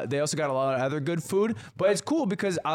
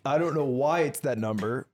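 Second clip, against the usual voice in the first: ordinary talk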